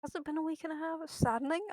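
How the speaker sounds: ordinary talk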